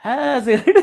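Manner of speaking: ordinary delivery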